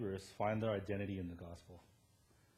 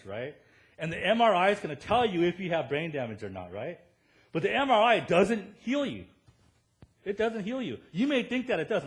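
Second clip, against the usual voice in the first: louder, higher, pounding preacher voice; preaching at full force; 6 semitones higher, 9 dB louder than usual. Acted. Pastor pressing the MRI point to his church, full-force preaching. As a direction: hammer the point home to the congregation.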